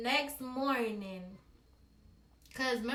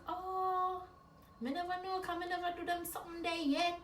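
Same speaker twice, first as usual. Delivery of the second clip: higher, Jamaican mother accepting; Jamaican mom; 6 semitones higher, 5 dB quieter than usual. Retold or imitated. Mother accepting daughter's excuse, higher Jamaican voice. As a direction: give in like a mom who believes it. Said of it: "she was like".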